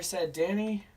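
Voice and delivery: plain reading voice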